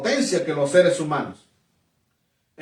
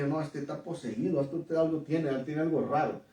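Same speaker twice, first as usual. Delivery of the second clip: hushed, low private aside; thinking to himself; 3 semitones lower, 9 dB quieter than usual. Retold or imitated. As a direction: drop the volume as if musing inwardly. Retold private thought about the neighbor.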